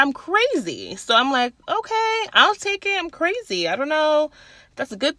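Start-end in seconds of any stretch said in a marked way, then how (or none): 1.7-3.7 high-pitched, softer playful concession; playful acceptance. Retold or imitated. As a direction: accept it in a high mock-agreeable voice. Acts out accepting being called crazy.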